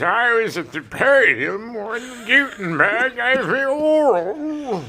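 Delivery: high, slow doddering commandant; lightly voiced old commandant; Commandant Lassard voice.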